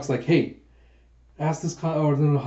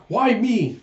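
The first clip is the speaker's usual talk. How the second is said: much higher, whiny complaint; indignant why me; protesting; 8 semitones higher, 4 dB louder than usual. Retold or imitated. Yun protesting at being handed the spear, high and whiny. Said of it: "who's protesting".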